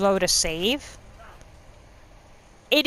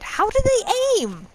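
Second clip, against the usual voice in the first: baffled; 13 semitones higher than usual. Real, not acted. Puzzled question about blind archers.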